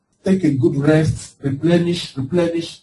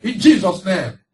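commanding; 6 semitones higher, 4 dB louder than usual. Shown as a demonstration. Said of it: "you can say".

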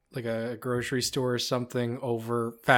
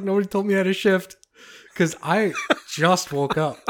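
higher, mock-outraged self-defence; indignant protest; 6 semitones higher, 4 dB louder than usual. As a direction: protest your innocence, pitched up, half laughing. Acted indignant protest to boss on phone.

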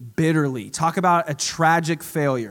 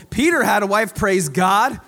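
louder, higher, exultant shout of praise; excited proclamation; 3 semitones higher, 5 dB louder than usual. Real, not acted. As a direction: celebrate it out loud. Preacher exclaims excitedly.

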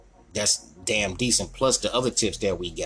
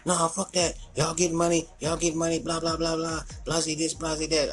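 high, whiny mock complaint; imitating the greedy temp, pitched up; mock whiny demand; 8 semitones higher than usual. Temp demands money, voiced high.

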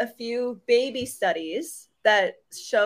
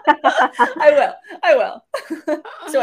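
laughing; 7 semitones higher, 9 dB louder, much slower than usual. Real, not acted. Laughing at the nose teasing.

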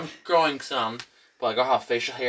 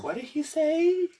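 high, slow mock disbelief; meme catchphrase; slower than usual. Friend quoting a meme for recognition, high drawn-out imitation. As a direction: drag it out with the pitch soaring. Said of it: "meme that was around".

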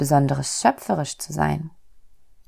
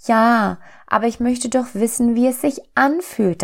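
high, whining little soul; 7 semitones higher, 3 dB louder than usual. A narrator's reading. Little soul pleads with God to feel the light, whining. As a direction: stretch the opening into a small plaintive wail. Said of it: "die kleine Seele jammerte".

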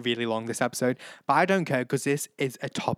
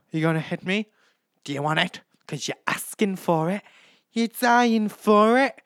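reality-show fight taunts; taunting quarrel; 7 semitones higher, 6 dB louder than usual. Retold or imitated. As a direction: goad them into a fight. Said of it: "This is from Big Boss".

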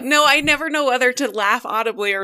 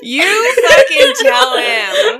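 loud; 9 semitones higher, 5 dB louder, slower than usual. Acted. Egging on, laughing shout.

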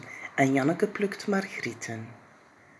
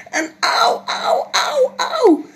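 high loud cries of pain, much higher; howling ouch; 7 dB louder, slower than usual. Acted. Jip crying out after the bee sting. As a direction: wail auw like a hurt little boy. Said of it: "hoort ze Jip gillen".

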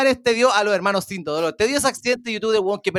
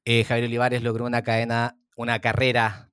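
Javier Olivares impression; 9 semitones lower than usual. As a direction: lower anchorman voice, measured and serious. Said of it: "tu imitación está muy buena".

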